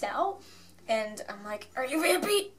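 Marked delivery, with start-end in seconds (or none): 1.8-2.5 frantic question. Retold or imitated. Frantically asking the lines.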